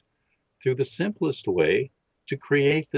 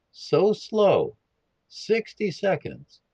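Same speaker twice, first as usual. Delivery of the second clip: slow and higher, stretching out the words; slowly drawn out; 8 semitones higher, slower than usual. Shown as a demonstration. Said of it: "You can't do this slow enough".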